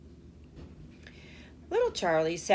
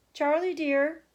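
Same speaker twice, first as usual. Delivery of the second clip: calling out; 7 semitones higher, 4 dB louder than usual. A narrator's reading. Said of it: "called out from where she was standing".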